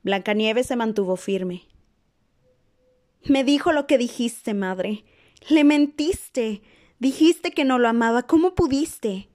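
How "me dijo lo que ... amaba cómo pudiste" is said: higher, louder, slow and breaking; hurt daughter lashing out; anguished accusation. A narrator's reading.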